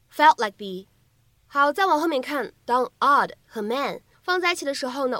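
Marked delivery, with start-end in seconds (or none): 0.1-0.9 "felt like the" joined, final stops held, "the" as "thee"; words run together. Shown as a demonstration. Teacher showing learners "felt like the" read together, linked. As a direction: link "felt like the" smoothly, ending on "thee". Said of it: "我们可以读成".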